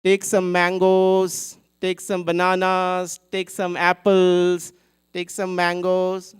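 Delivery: loud, slow vendor's call; calling out fruits. Acted. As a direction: hawk your fruit to the crowd. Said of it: "she's calling out to everybody".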